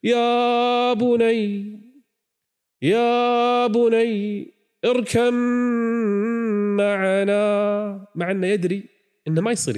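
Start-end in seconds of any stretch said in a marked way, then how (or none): 0.0-8.1 high, very slow pleading call; father calling out. Acted. Noah calling his drowning son, drawn-out plea. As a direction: stretch it out, calling across the water.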